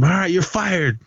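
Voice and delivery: high-pitched retold firing; mock outburst. Teacher firing the choir teacher, high-pitched mock outburst. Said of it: "he just lights up".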